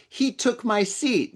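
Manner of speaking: slow, high mock yell at ordinary volume; retold huge-voiced accusation, high and drawn out; imitated yell. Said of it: "said with a huge voice".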